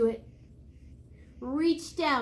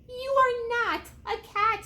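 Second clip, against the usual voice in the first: insistent mother; 8 semitones higher than usual. A narrator's reading. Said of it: "his mother said".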